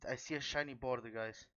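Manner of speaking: ordinary talk, nothing marked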